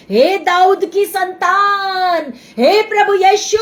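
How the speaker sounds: high loud cry, drawn out slowly. Retold or imitated. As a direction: call out to Jesus from afar. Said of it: "और जोर से चिल्लाने लग गया".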